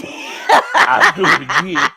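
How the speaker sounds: loud, drawn-out, laughing declaration; slow it right down and belt it through laughter; laughing insistence. Real, not acted. Telling the co-host he would repeat it, laughing loudly.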